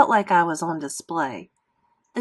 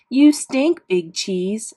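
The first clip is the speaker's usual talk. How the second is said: put-on sing-song kid taunt, louder; mocking taunt; 3 semitones higher, 4 dB louder, much slower than usual. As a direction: spit it out like a schoolyard bully. Bully's mean note read out.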